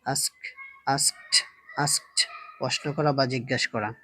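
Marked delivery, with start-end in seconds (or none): 0.9-2.2 আস্কড ending in a crisp t, as "askt"; final -ed as T. Shown as a demonstration. Teacher shows learners the past-tense ending, t at the end of আস্কড. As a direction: finish আস্কড on a t sound instead of d. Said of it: "টি sound হবে".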